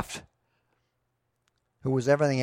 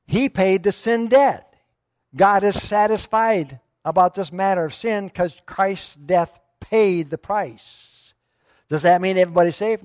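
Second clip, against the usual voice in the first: raised, slow, emphatic preaching; forceful preaching; 6 semitones higher, 7 dB louder, slower than usual. Acted. Pastor to congregation, about Christ paying sin's price, raised and emphatic preaching. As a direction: drive home the sin debt from the pulpit.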